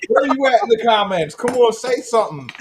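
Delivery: high, loud taunting call-out. Real, not acted. Host goading an internet critic in the comments, mocking taunt. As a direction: goad the absent critic to speak up.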